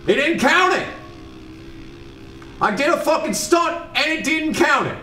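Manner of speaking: high-pitched screaming outrage; furious shout. Real, not acted. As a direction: scream at the game in disbelief. Stunt not counted, raging.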